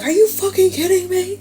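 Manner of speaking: incredulous exasperated squeak; mock exasperation. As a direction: voice someone fed up with life. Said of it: "look at the frustration".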